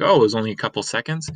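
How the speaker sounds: usual speaking voice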